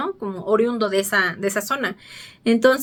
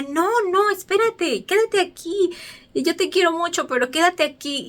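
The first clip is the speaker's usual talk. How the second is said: tender pleading; 8 semitones higher than usual. Retold or imitated. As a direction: plead gently like saying goodbye to a pet. Man pleads with penguin to stay.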